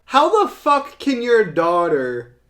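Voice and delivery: real exasperation. Real, not acted. Reviewer pissed off at the daughter's line, exasperated rant. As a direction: rant in loud disbelief. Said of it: "That pissed me off".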